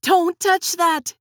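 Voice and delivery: high, loud, slow warning yell; urgent yell. Acted. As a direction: shout to stop him in time.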